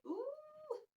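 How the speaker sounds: a very high, squeaky mock "Ooh"; mock surprise. Mocking the friend who blocked her, mock surprised squeal. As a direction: squeal it like fake shock.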